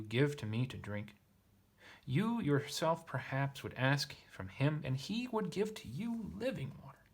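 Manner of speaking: lifted, emphatic reading voice; expressive reading of a literal translation; scripture read aloud. A narrator's reading. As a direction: declaim the verse with swooping stresses. Reads the passage aloud.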